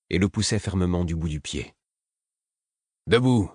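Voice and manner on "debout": a rough, irritated wake-up call, a bit louder; hargneux, un peu plus fort; snarling order. A narrator's reading. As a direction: snap him awake. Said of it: "fis-je d'une voix hargneuse".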